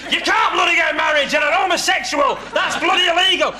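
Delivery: shouting flatmate. Retold or imitated. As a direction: yell it as the outraged flatmate.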